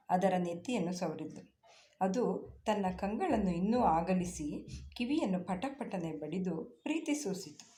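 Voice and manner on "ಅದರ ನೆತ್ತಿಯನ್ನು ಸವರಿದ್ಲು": ordinary delivery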